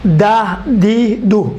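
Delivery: دا، دی، دو sounded out slowly; slow syllable drill. Shown as a demonstration. Teacher correcting نعبد, slow syllable drill. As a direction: draw out دا، دی، دو one by one. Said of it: "نعبد ہے".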